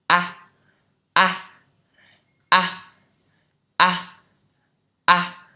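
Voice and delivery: exaggerated wide-mouthed A; teacher's exaggerated A, mouth wide open. Shown as a demonstration. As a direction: drop the jaw wide and say A loud. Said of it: "mở miệng rất là to".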